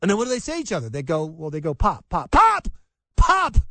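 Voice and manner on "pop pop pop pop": pops building to loud high shouts; mock gunshots. Boys playing guns, pops building to loud shouts. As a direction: fire off toy gun pops, getting louder. Imitating boys' gunfire.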